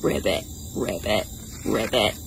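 very low, soft croaks; deep little ribbits; frog ribbit. Shown as a demonstration. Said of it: "What does a frog say?".

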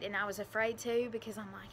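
ordinary talk

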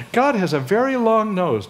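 amused, laughing as he tells it. Real, not acted. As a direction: share the joke with a laugh. Joke about long of nose.

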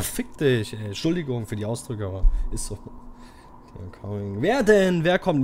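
ordinary talk, nothing marked

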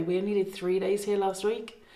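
normal talking voice

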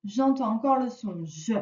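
ordinary delivery

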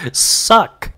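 high, loud punchy "suck"; sharp emphatic verdict. Real, not acted. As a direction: snap out the verdict. Punchline after filler.

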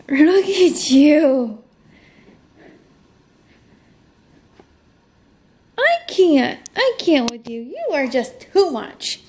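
loud, high, delighted gushing; can't contain how cute the dog is; overjoyed squealing over the pet. Real, not acted.

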